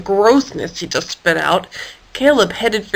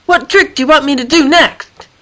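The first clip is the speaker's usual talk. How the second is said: calling out; 5 semitones higher, 3 dB louder than usual. Acted. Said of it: "David called out to him".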